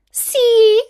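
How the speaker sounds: very high, loud single word; triumphant exclamation. A narrator's reading. Wicked queen proves her point to the king, high triumphant exclamation. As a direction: pounce on it like proof. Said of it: "exclaimed the queen".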